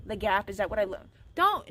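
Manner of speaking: ordinary talk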